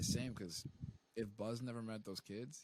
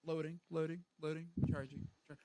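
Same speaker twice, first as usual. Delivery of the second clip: toy robot voice; 6 semitones higher than usual. Retold or imitated. Voicing robot shutting down.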